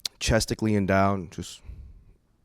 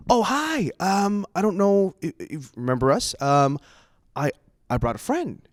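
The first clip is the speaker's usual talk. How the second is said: hesitant greeting, starting high; returning missionary; 6 semitones higher than usual. Retold or imitated. Missionary back at the door with a friend, hesitant imitation. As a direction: imitate an awkward, halting visitor. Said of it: "The first guy from yesterday was like".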